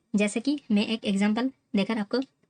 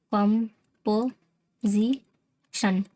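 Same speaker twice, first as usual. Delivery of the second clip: "Composition" very slowly in four separate beats; "कम", "पो", "जी", "शन", pausing after each; syllable by syllable; much slower than usual. Shown as a demonstration. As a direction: sound out "composition" for learners. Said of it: "कैसे अलग करेंगे".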